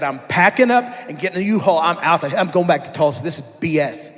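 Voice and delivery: loud, furious retold outburst; mad. Pastor on the phone to his friend, loud angry quitting rant. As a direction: blast it out like you're quitting on the spot. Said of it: "I was so mad".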